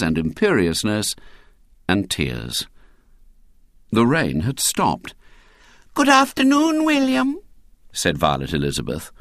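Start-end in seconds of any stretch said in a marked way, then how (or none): 5.9-7.4 put-on high, lilting little-girl voice. A narrator's reading. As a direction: greet him as a small girl. Little girl greets William.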